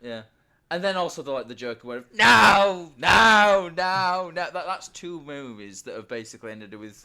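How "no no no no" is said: loud, high, desperate screaming no. Retold or imitated. Joker ending where someone just screams, loud screamed nos. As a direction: scream each no at the top of your voice. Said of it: "someone just screaming".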